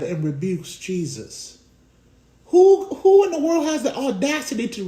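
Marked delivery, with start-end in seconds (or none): none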